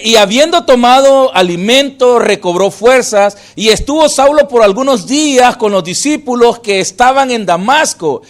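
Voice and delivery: loud, emphatic Bible reading; preached reading aloud; forceful scripture reading. Acted. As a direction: proclaim the verse to the congregation.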